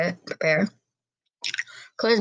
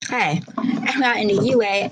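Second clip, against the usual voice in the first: ordinary delivery, nothing marked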